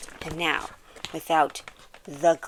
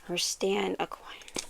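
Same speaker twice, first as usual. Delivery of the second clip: whispering; 2 semitones higher, 8 dB quieter, slower than usual. Pretend. Announcing item like a game.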